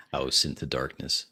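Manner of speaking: usual speaking voice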